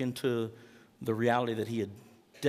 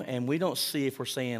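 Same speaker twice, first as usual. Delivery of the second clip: ordinary talk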